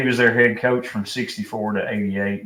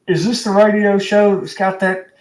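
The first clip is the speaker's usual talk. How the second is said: higher, louder mock caller on the radio; old caller voice; 9 semitones higher, 5 dB louder than usual. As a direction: put on a fired-up old caller's voice. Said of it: "he goes".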